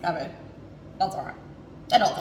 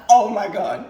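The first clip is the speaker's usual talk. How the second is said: loud delighted oh my God; excited exclamation; 8 dB louder, slower than usual. Retold or imitated. Hungover host remembering the chicken, loudly delighted. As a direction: burst out with delight. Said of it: "I was like".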